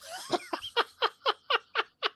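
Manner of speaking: high squealing laugh; burst of laughter. Real, not acted. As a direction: break up laughing hard. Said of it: "I just started busting out laughing".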